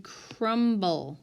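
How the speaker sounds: "crumble" drawn out with a clear B; sounded B. Shown as a demonstration. Teacher showing students a sounded consonant, "crumble" with an audible B. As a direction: say "crumble" slowly as CRUM-BULL, B fully sounded. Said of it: "You hear the B".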